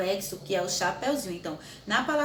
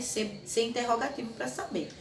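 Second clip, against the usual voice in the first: usual speaking voice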